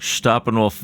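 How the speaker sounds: Steppenwolf said as SHTOP-en-wolf; copied correct pronunciation. Shown as a demonstration. Host tries guest's version, Steppenwolf as Shtoppenwolf. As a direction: echo Steppenwolf with a "shtop" start. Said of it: "knowing that it's pronounced Steppenwolf".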